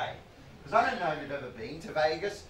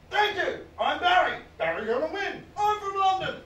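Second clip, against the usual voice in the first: put-on high, loud blurting man-child voice; bellowing Barry; 13 semitones higher, 5 dB louder than usual. Barry greeting the waitress, loud bellow. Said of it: "Barry bellowed at her".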